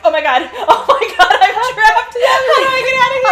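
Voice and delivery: high, loud laughing panic; mock panic. Fan hams up being trapped with a stranger, high laughing panic. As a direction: shriek it out as if trapped, half laughing. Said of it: "imagine the panic of".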